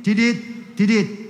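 steady beeping, tidit, tidit; monitor beep imitation. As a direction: mimic a heart monitor's even beep. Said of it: "el monitor hacía tidit".

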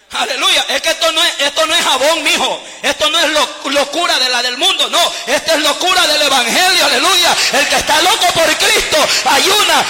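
loud, driving, shouted exhortation; full-force preaching. Real, not acted. Preacher exhorts the congregation on being mad for Christ, full-force preaching. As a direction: build to a ringing shouted hallelujah.

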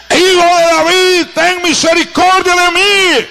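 high, slow, drawn-out cry for mercy; Bartimaeus's cry. Retold or imitated. Blind Bartimaeus calling to Jesus, a high, slow cry. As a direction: call out to Jesus across the street. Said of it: "comienza a gritar".

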